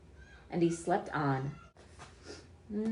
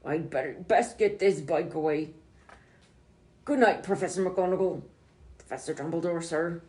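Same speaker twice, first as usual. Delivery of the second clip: Hagrid voice; 6 dB louder than usual. Acted. Hagrid voiced in read-aloud.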